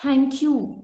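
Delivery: slow teacher's thank you; slow spelled out. Shown as a demonstration. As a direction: thank you said very slowly and carefully. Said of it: "इसको thank नहीं बोलेंगे".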